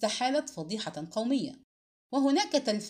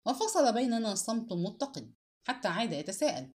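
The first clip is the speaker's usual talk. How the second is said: ordinary delivery, nothing marked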